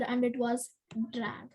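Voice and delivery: ordinary delivery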